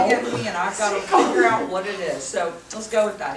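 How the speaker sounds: loud, laughing excitement settling down; excited. Real, not acted. Medium still excited and loud, working out the message. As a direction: keep the giddy energy but start to focus.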